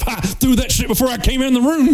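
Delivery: high, fast, sing-song boast. Retold or imitated.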